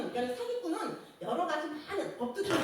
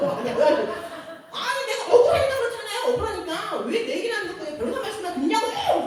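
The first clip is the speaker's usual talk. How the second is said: high, loud protest of injustice; reenacted shout; 8 semitones higher, 9 dB louder than usual. Wronged litigant shouting back at the judge, loud protest. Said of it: "내가 막 소리 막 치고".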